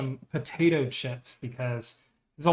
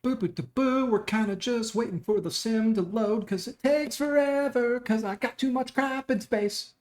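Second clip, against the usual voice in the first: high sing-song voice; 8 semitones higher than usual. Real, not acted. Idle sing-song while sim loads.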